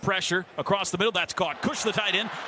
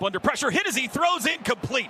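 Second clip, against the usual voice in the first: excited call; 6 semitones higher than usual. Real, not acted. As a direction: punch up the hit as it happens. Quarterback hit called live.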